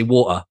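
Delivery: "water" with a dropped T, British style; "water" without a hard T. Shown as a demonstration. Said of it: "like a normal person, I say water".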